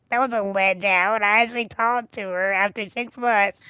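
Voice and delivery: ordinary talk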